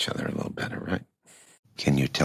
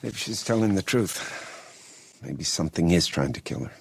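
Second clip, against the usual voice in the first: normal talking voice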